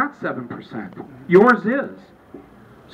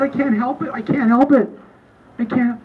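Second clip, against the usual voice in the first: higher, mildly overwrought pleading; mock emotional protest; 5 semitones higher, 3 dB louder than usual. Red-eyed husband answers his wife, mildly overwrought. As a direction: protest helplessly, pitched up, half playing it. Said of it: "I was all red-eyed".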